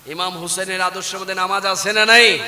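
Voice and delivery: insistent, projected question; firmer question. Real, not acted. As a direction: press the congregation for an answer. Asks again after the soft take.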